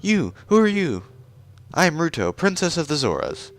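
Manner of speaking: put-on high-pitched haughty young princess; haughty princess Ruto. Princess Ruto introducing herself to the stranger, high and proud.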